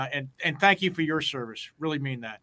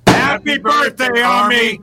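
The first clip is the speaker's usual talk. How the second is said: big, slow, gung-ho soldier shout; loud motivated cheer; 8 semitones higher, 12 dB louder, slower than usual. Real, not acted. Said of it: "you can't hold back. You gotta be motivated".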